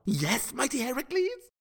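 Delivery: high-pitched eager answer; eager, fawning reply. Acted. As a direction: pipe up eagerly to your hero. Eager reply to his hero.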